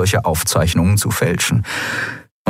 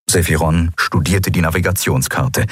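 usual speaking voice

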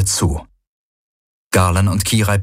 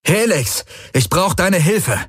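high, drawn-out urgent call; raised voice; 10 semitones higher, slower than usual. A narrator's reading. Pilot calls Helix over sirens, raised voice. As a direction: call for help over the din. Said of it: "Er hob die Stimme über die Kakophonie".

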